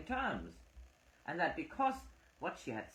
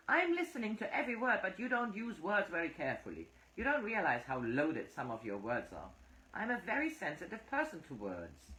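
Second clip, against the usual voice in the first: rapid, higher protest; hurried higher defence; fast heated defence, higher; 6 semitones higher than usual. A narrator's reading. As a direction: rush the words out defensively. Phyllis defending herself heatedly.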